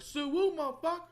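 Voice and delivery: high, loud imitated street holler; mock gang call. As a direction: holler it like a challenge, for laughs. Acting a stranger calling out.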